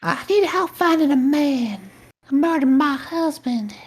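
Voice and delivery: high, slow, breathy-free sultry noir dame; femme fatale voice. Acted. Femme fatale bit in VR noir scene.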